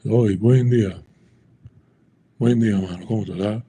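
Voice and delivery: casual, run-together Dominican Spanish; Dominican street Spanish. Shown as a demonstration. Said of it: "people don't talk like that".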